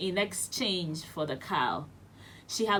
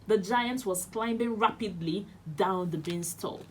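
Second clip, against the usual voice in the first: ordinary delivery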